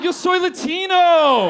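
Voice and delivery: triumphant comedic shout; proud shout. Acted. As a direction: yell it out like a rally cry. Shouting claim at punchline.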